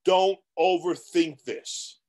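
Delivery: usual speaking voice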